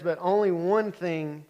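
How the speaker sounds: spoken slowly, each word deliberate; slow emphatic teaching line; slow, weighty. Retold or imitated. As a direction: let the words land. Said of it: "And he says".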